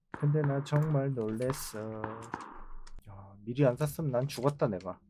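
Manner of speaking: ordinary delivery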